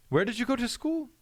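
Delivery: high, put-on accent of a snooty banker; mock posh accent. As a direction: ask it like a condescending stranger at a bank event. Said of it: "I don't know why this accent is the way it is".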